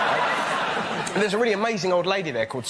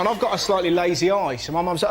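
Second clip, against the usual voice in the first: ordinary delivery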